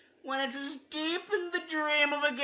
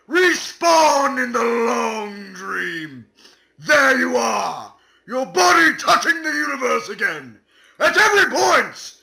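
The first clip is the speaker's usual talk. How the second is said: loud, slow, booming commands; dramatic shouting; 2 semitones higher, 12 dB louder, slower than usual. Acted. Hamming up the respawn for friends, slow booming shout. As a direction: proclaim it like a summoning.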